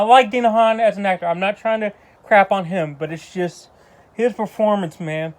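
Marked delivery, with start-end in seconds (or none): none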